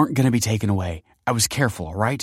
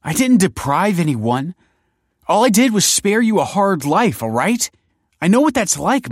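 high, loud, desperate outburst; impassioned protest; 13 semitones higher, 8 dB louder than usual. A narrator's reading. Kelsey insists she spared him a hard life, impassioned. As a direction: justify yourself at full pitch. Kelsey's heated, tearful self-defence.

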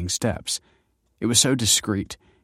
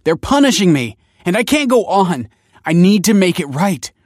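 high, loud desperate plea; raised, urgent pleading; 11 semitones higher, 9 dB louder than usual. A narrator's reading. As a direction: plead as if at breaking point. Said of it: "pleaded Michael".

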